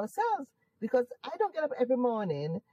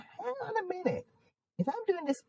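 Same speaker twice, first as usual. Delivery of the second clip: imitated sudden realisation; 11 semitones higher than usual. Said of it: "I thought".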